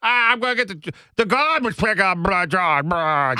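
hammed-up mush-mouthed mayor voice; Tom Menino. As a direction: blurt it out high in a mangled politician's mumble. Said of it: "Just start talking like that".

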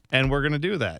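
ordinary delivery

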